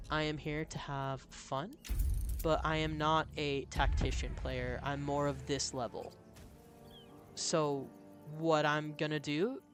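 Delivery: usual speaking voice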